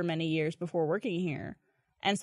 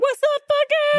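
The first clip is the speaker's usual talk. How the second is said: high, loud, brash greeting; brash shout; 9 dB louder, slower than usual. Pretend. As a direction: bark it out cheerfully rude. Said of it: "I'd be like".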